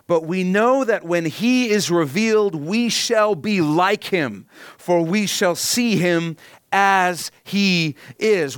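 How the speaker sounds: slow, weighty reading of scripture; slow solemn proclaiming; preaching scripture. Acted. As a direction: lean on each word like a proclamation.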